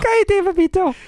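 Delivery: put-on high, sharp mom hushing a kid; mama's scolding voice. Repeating mama's line after praise. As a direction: snap "Cállate, papito" high like a mother. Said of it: "Very good".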